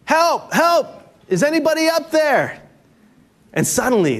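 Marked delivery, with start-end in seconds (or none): 0.1-2.6 high, desperate cry for help. Acted. Hiker dangling from a branch calls for help, high panicked cry. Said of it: "he begins to cry out".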